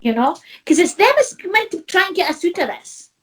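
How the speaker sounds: normal talking voice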